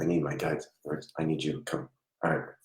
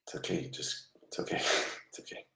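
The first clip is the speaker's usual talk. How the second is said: soft reassurance; 13 dB quieter, faster than usual. Real, not acted. Medium calming a hysterical spirit.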